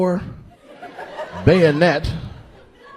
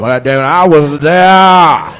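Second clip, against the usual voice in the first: slow loud boast; 8 dB louder, slower than usual. Acted. Old man boasting loudly.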